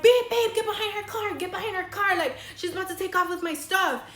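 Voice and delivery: high, frantic re-enacted urging; urgent alarm. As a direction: urge a partner to give chase, fast and high. Urging boyfriend to chase the car.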